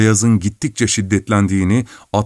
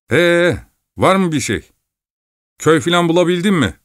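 higher, fussy miller's voice; grumbling miller; 8 semitones higher than usual. Acted. Miller scolding the driver about the lost way, grumbling. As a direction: nag him about the lost road.

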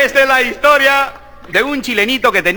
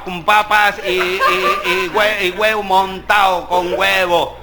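ordinary delivery

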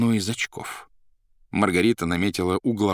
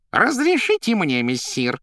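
high, louder, eager plea; joyful cry; 11 semitones higher, 5 dB louder, slower than usual. A narrator's reading. Cat begging Woland for leave, joyful high cry. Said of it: "вскричал радостно кот".

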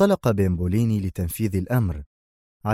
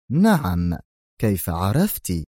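ordinary delivery

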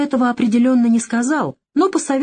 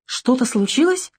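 a touch of alarm, higher pitch; mildly alarmed; 5 semitones higher than usual. A narrator's reading. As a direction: ask with slight worry, voice lifted. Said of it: "встревожилась она".

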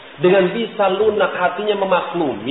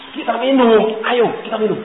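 a friend's eager invitation, pitched up; voicing a friend; 7 semitones higher than usual. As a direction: coax him to come drink. Friend's invitation voiced, pitch raised.

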